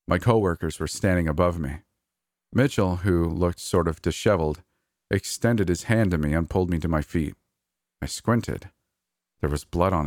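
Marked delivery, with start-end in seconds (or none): none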